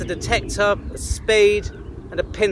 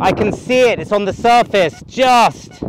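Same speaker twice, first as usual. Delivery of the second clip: raised voice against a strong gale; shouting over wind; 3 semitones higher, 4 dB louder than usual. Real, not acted. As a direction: project loudly over the wind.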